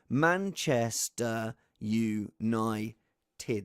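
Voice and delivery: Manchester United drawn out very slowly, each of its syllables spelt out; slow, every syllable. Shown as a demonstration. Host showing the six syllables, very slow. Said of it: "That is six syllables".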